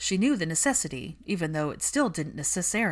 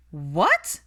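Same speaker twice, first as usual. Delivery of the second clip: sudden loud screeched what; 7 dB louder than usual. Acted. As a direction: shriek it out in shock. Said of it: "Pansy screeched loud enough".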